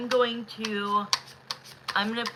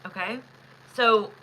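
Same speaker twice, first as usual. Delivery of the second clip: ordinary delivery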